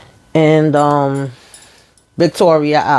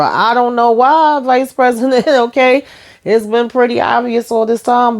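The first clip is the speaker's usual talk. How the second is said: exasperated disbelief; 7 semitones higher than usual. Real, not acted. Exasperated aside to the character.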